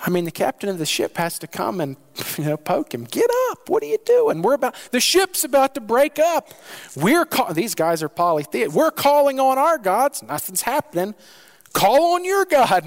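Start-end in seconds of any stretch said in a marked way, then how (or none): 3.1-7.5 high, loud frantic shouting to wake him; panicked ship captain. Acted. Captain shaking Jonah awake, frantic high shout. 8.7-11.2 desperate captain. Acted. Captain urging Jonah to call on God, desperate. 11.7-12.9 high, loud, slow urgent pleading; desperate captain. Acted. Captain urging Jonah to call on God, desperate.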